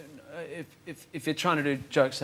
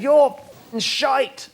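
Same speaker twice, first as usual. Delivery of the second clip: high-pitched mock heckle; imitated heckler; 10 semitones higher, 7 dB louder than usual. Comedian shows what some bloke went, mock heckle. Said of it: "like this".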